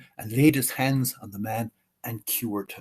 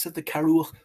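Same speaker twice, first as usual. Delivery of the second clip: usual speaking voice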